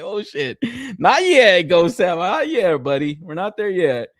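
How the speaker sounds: high, loud playful panic; joking with Ghost Sam, high and playfully alarmed; mock alarm. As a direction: shoo away the ghost. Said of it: "I was like".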